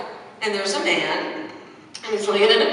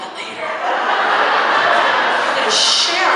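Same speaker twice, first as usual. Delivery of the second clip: mock therapist; 7 semitones higher, 4 dB louder, much slower than usual. Mocking a therapist's tone.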